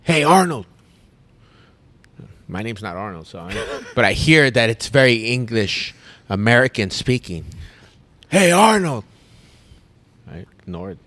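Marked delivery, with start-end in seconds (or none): 0.0-0.7 loud, higher call across the bar; calling out a name. Retold or imitated. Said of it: "like, an American accent". 8.3-9.0 loud, higher call across the beach; calling out again. Retold or imitated. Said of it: "like, an American accent".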